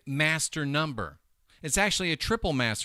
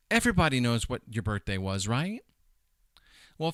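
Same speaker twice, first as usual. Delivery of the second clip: ordinary talk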